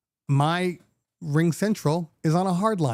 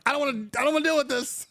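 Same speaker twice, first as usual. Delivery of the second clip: whiny exasperated self; 11 semitones higher, much faster than usual. Retold or imitated. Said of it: "I'm like".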